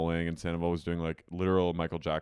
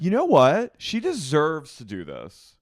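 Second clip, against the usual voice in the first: loud, high start, then slower; emphatic retort; 7 semitones higher, 8 dB louder, slower than usual. Retold or imitated. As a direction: push back hard in her defence. Acting out her retort to Lala.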